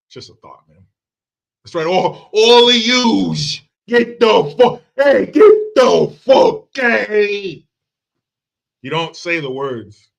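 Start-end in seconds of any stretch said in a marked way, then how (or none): 1.9-7.6 high, loud, drawn-out bad Italian accent. Ordering all of them out, bad Italian accent yell. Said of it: "do that Italian accent".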